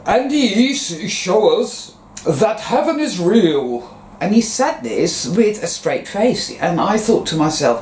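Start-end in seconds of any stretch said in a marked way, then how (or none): 0.1-3.9 serious doctor. Retold or imitated. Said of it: "he spoke in this very serious voice".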